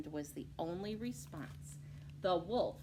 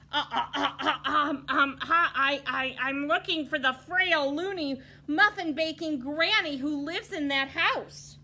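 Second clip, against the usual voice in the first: loud, higher frightened stammer; stammering wolf; 9 semitones higher, 11 dB louder than usual. Acted. Caught wolf explaining himself, stammering. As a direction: trip over every word in panic. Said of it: "gasped and stammered".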